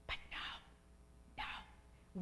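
whispered soft no, no; hushed no. Real, not acted. Preacher to congregation, whispered denial. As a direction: drop to a breath to deny it.

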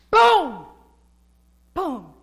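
loud, high burst of boom; exclaimed boom. Acted. Preacher describing answered prayer, loud exclaimed boom. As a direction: punch the word out like an explosion.

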